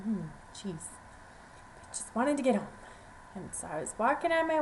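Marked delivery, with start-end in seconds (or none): none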